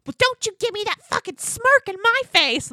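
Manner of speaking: high, loud imitation of a screamed confrontation; mock screaming. Retelling the party fight to a co-host, mock screaming. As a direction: screech it as a mock reality-show tirade.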